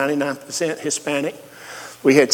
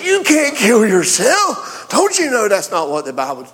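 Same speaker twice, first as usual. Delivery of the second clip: mock scolding; 5 semitones higher than usual. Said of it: "So I didn't say".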